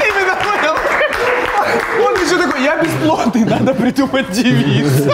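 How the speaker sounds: squeaky high laughing. Real, not acted.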